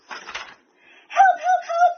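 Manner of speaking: very high, loud panicked cry; crying for help. A narrator's reading. Hippo begging for rescue, high loud shriek. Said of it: "cried the hippo".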